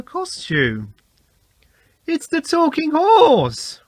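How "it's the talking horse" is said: high, loud, slow delighted cry; excited cry. A narrator's reading. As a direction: call out in discovery. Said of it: "cried Thomas".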